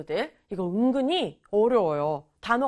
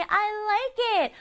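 put-on child's delight; 9 semitones higher, much slower than usual. Child answers happily.

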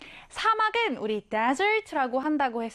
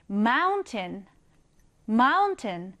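"mountain" with a strong first syllable and a weak, swallowed end, MOUN-tn; stress on the front; 3 semitones lower, much slower than usual. Shown as a demonstration. Teacher models "mountain" to the class, stress on the front. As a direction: say "mountain" heavy on MOUN and light on the tail. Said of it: "강세를 앞에 강하게 넣어주면서 뒷부분은 약하게".

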